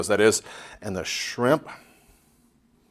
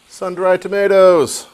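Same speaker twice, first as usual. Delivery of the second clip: excited announcement; 8 semitones higher, 8 dB louder than usual. Real, not acted. Remembers forgotten ingredient, announces it.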